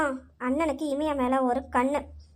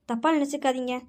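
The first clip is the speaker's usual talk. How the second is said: normal talking voice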